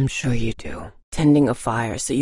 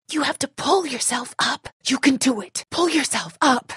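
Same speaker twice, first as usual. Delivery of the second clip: urgent encouragement; 10 semitones higher than usual. Acted. Urging Lee to climb up.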